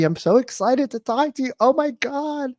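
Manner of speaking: high-pitched gushing excitement; happy puppy. Shown as a demonstration. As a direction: gush like an over-eager puppy. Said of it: "The happy puppy".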